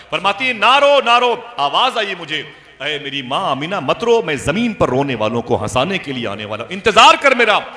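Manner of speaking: rousing sermon voice; impassioned preaching. Real, not acted. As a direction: retell it fervently, bursting loud at the end.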